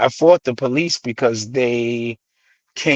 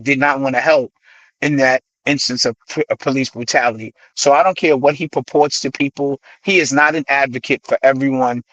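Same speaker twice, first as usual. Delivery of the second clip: ordinary talk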